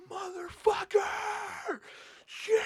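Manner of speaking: high, drawn-out cursing shout; retold furious scream. Driver screaming curses while flooring it on highway, furious shout. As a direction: scream the curses like he's lost it. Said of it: "Just screaming".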